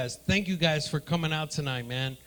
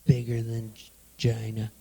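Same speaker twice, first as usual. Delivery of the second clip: Trump impression; 8 semitones lower than usual. Comic boasting to the crowd in a low impression voice. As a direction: puff up like a bragging president. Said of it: "That was my attempt at an impression".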